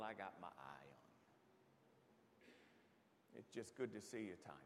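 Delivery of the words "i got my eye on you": mock warning. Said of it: "Missus Holliday was like".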